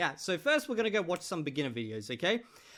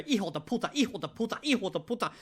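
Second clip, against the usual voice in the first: imitating Martin swearing; 4 semitones higher than usual. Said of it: "run around and just go".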